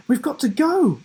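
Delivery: high, loud urgent push; urging. A narrator's reading. Giraffe urging the children to hurry, high and loud. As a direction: hurry them along. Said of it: "urged Sam".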